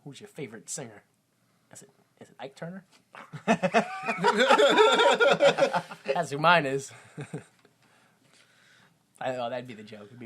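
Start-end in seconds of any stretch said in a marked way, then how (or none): 0.0-2.8 hushed, fast conspiratorial whisper; whispered joke. Acted. Whispered dark joke setup. 3.1-6.1 high burst of laughter; laughing. Real, not acted. Laughing at own joke.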